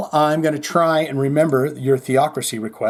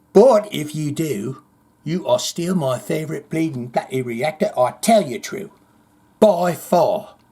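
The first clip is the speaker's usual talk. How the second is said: mock British accent. Host defying viewer's advice to drop it, mock British accent. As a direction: put on an exaggerated British accent. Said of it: "if you never attempted a British accent".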